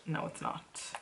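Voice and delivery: whispered retort under the breath; hushed, voiceless denial. Real, not acted. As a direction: whisper it back to yourself.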